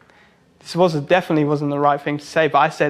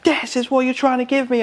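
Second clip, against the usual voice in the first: high, strained mock outrage; imitating a client freaking out; 9 semitones higher than usual. Client confronting the tattooist over the design, high-pitched freak-out. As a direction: pitch it way up in indignant disbelief. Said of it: "He starts freaking out".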